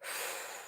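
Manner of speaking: long, soft exhale; audible sigh of release; deep breath out. Shown as a demonstration. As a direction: demonstrate letting a breath all out. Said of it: "Take that deep breath out".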